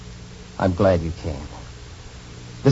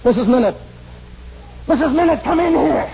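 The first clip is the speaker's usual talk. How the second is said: urgent call for the housekeeper; 13 semitones higher than usual. Acted. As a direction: shout for her from the next room. Calling the housekeeper in.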